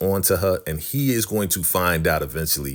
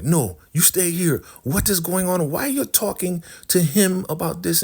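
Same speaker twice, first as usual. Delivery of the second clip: high put-on indignant voice; hammed-up high interrogation; mock demanding woman; 12 semitones higher than usual. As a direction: demand answers in a high mimicking voice. Elder Mother demands answers in retold quarrel.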